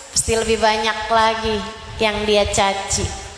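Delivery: humble insulted prayer. Acted. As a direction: pray humbly after being insulted. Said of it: "Lagi dicaci, ingat Allah". (doa rendah hati saat dicaci)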